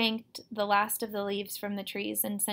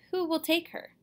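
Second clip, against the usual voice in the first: higher, urgent demand; mild narrated yell, pitched up; yelled question; 8 semitones higher than usual. A narrator's reading. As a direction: demand an answer in alarm. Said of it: "I yelled".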